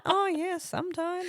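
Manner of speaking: put-on piping, offhand little-boy answer; imitated young son. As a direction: agree like a small kid. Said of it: "And he's like".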